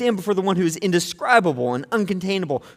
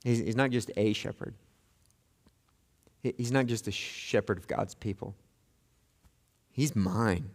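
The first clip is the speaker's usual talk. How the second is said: hushed tender; 7 semitones lower, 6 dB quieter than usual. Acted. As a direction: speak softly and low as if to one person.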